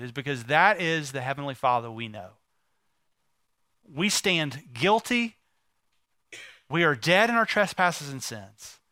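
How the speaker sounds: normal talking voice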